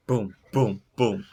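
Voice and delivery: loud, evenly spaced booms; imitated pounding. Game master imitating a pound on the door, loud booms. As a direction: thump out each boom separately.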